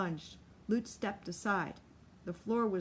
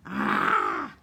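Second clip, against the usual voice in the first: loud raw yell of pain; 10 dB louder than usual. Acted. Solly crying out while grappling with Lute. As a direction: bellow out in the struggle. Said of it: "Solly Miller cried".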